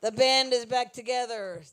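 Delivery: booming stage introduction, slowed down; loud announcing. Real, not acted. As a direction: call the band onto the stage. Host introduces band on stage.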